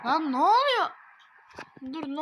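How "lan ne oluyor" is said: loud, high what's-going-on yell; startled shout. Real, not acted. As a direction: shout it out in shock. Glitch, shouted second take. (şaşkın bağırış)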